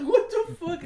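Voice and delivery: high, loud laughing shock; startled. Retold or imitated. Guy finding Edler's balls on him, laughing startled yelp. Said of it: "it's just like".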